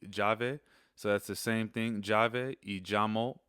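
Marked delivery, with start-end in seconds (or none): 0.1-0.6 "llave" said as jah-veh, the double L as a J; Spanish pronunciation. Shown as a demonstration. Teacher models "llave" right after spelling it. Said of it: "It's double L A V E". 2.0-3.4 "Llave y llamo" slowly, each double L as a J; Spanish pronunciation. Shown as a demonstration. Teacher contrasts two words, both with a J sound. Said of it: "it produces a J sound".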